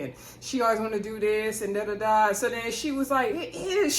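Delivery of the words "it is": high mock holler; imitated holler. Wife cutting in on husband across the bar, imitated holler. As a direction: imitate a wife calling out, pitched up. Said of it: "She hollered at him across the bar".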